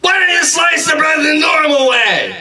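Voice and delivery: very high, sustained comic yell; shouted complaint. Acted. Customer to Subway worker, exasperated high-pitched shout. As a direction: yell it on one high note, dropping at the end. Same acted outburst at worker.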